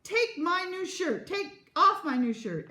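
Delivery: slow, high, emphatic order; heated childish insistence; angry demand. A narrator's reading. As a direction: demand it word by word, loudly. Parents quarrelling over shirt.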